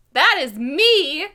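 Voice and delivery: slow, loud, high and cheery; peppy. Retold or imitated. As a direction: announce yourself brightly, drawn out. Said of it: "I was very peppy".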